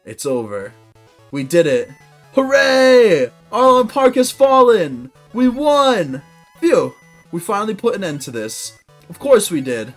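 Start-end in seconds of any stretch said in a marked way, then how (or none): none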